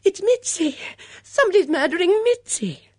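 very high, loud, hysterical call; terrified wail at full pitch; panicked cry. A narrator's reading. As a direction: shriek the alarm tearfully. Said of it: "called out".

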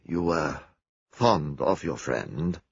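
quiet, gentle question to a grieving friend; gently. Acted. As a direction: ask tenderly, coaxing the truth. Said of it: "Poirot said gently".

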